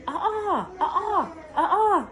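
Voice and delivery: high, loud pained uh-uh with a laughing cry; contraction pain. Retold or imitated. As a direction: caught by a contraction mid-needle. Contraction starts during epidural.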